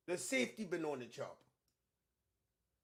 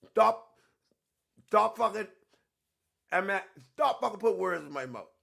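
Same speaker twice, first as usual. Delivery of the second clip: real angry yell; 2 semitones higher, 8 dB louder than usual. Real, not acted. Angrily rebukes viewers putting words.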